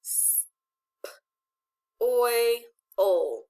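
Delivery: S-, p-, oi, ol with a pause after each sound. Shown as a demonstration. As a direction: sound out S-, p-, oi, ol separately. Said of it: "What's this word?".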